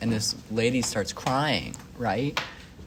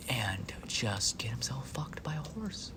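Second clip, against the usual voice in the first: hushed slow whisper; 12 dB quieter, slower than usual. Real, not acted. Telling the friend the grim horse story in a whisper. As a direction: drop to a whisper for the lurid bit. Lurid detail dropped low.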